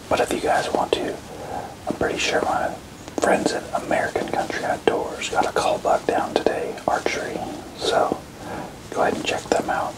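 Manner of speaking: hushed hunting whisper. Real, not acted. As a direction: whisper so the deer won't hear. Whispered hunt update.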